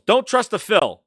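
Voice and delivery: emphatic warning. Real, not acted. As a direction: warn them loudly.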